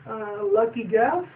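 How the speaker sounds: high, slow unsure voice; sheepish guess. Retold or imitated. Sweetie Belle caught, high sheepish guess. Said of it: "they all look at Sweetie Belle".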